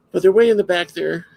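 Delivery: talking through a yawn; yawning through the words. Real, not acted. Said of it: "I don't know why I'm yawning".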